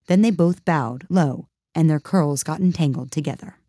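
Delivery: usual speaking voice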